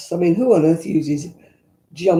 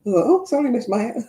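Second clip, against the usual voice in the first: higher, sheepish apology to the headmistress; 6 semitones higher than usual. Retold or imitated. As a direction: squeak it out like a caught schoolgirl. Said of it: "I thought".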